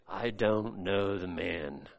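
low, soft and slow, almost under the breath; a low, drawn-out murmured denial; hushed denial. Acted. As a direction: deny it quietly, slowly, as if ashamed. Peter backs lie with oath.

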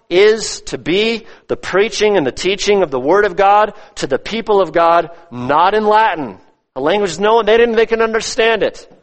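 high, insistent pulpit voice; impassioned preaching. Real, not acted. Preacher exalts the Word over the altar, in impassioned preaching. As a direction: preach it with lifted, driving emphasis.

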